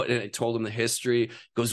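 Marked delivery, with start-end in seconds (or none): none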